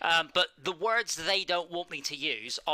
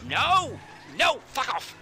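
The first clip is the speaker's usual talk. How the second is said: shouting; 7 semitones higher, 4 dB louder, slower than usual. Pretend. Mock outburst at swearing topic.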